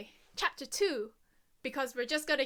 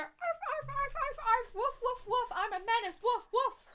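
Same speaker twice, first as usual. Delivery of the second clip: high yappy mock barking; playful high dog barks; 11 semitones higher, 5 dB louder than usual. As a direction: yap like a little menace dog. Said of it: "just hear".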